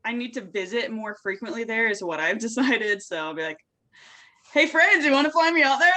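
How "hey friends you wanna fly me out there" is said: loud, higher mock request; playful calling out. Guest jokingly asking friends to fly her out, loud playful appeal. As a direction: call out brightly to friends, hamming it up.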